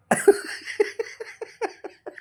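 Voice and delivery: airy, unvoiced giggle; breathy laugh. Real, not acted. Parent laughing at baby. (श्वासातलं हसू)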